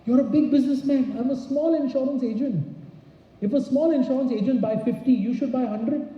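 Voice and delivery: confident high-pitched sales pitch. Shown as a demonstration. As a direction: lift the pitch and push the close. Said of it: "I tell them".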